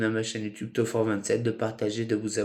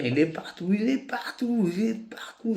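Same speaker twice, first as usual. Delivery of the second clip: high-pitched refrain; 11 semitones higher than usual. Acted. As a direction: voice leaping very high on each repeat. Poem's point repeated as a rising cry.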